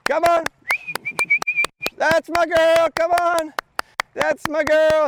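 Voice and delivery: happy high praise voice; excited dog praise. Real, not acted. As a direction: cheer the dog on.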